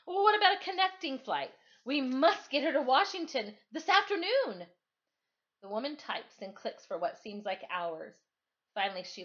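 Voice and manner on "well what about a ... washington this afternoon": high, loud, pleading father's voice; voiced dad begging for a flight; urgent father. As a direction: push the agent, desperate to get her there. Dad pleading with gate agent.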